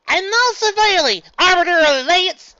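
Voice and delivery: high, loud mock-formal shout; crying out. Evelyn echoing Luna's heckle, loud shout. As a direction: proclaim it loudly. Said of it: "Evelyn cried".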